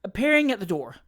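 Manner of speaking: plain reading voice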